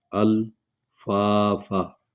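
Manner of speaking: very slow, अल्फाफा with alif and laam read together; joined alif-lam. Shown as a demonstration. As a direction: glide अल्फाफा as one smooth word, very slow. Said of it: "अलिफ और लाम को इकट्ठे पढ़ रहे हैं". (अल्फाफा में अलिफ और लाम मिलाकर धीरे)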